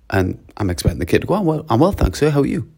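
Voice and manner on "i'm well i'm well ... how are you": higher, courteous pupil's reply; polite pupil. Acted. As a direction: answer a teacher with lilting politeness. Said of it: "being really polite".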